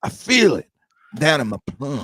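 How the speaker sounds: high, swooping Southern accent impression; Ashley Schaefer. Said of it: "Will Ferrell nailed that accent".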